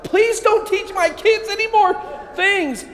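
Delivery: high, slow pleading mom voice; hammed-up mother begging the priest to stop; exasperated mom. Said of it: "his mom was like".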